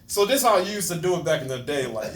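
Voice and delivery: ordinary talk, nothing marked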